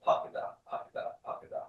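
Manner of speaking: "pakada" with the middle vowel cut to a quick schwa; reduced middle vowel. Shown as a demonstration. Teacher showing students the vowel reduction, reduced middle of "pakada". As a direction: say "pakada" softly and quickly, barely voicing the middle "a". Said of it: "get that reduction in the middle".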